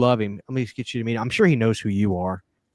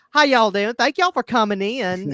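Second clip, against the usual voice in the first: southern hostess; 9 semitones higher, 4 dB louder than usual. Retold or imitated. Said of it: "the hostess is like".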